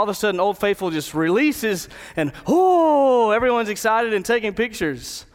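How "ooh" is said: a big rising ooh, higher and louder. Retold or imitated. Preacher voicing the crowd's loud ooh at Old Faithful. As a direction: swell into the crowd's amazed ooh. Crowd's awe as geyser erupts.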